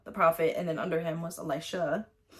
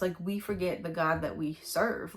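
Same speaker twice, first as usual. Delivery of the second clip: normal talking voice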